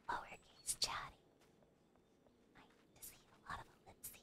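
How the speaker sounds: soft ASMR whisper. Real, not acted. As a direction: breathe the words, no voice. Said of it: "we are still A S M R".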